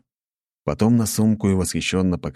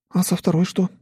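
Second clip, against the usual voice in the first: curious; 13 semitones higher than usual. A narrator's reading. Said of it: "с интересом спросила Анастасия".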